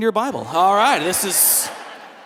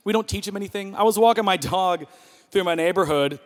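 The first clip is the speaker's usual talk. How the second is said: usual speaking voice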